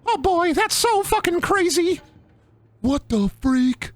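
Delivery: high, cartoonish panic; high mock alarm. Streamer spots a moved standee, high mock alarm. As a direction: squeak it out, stretching the words.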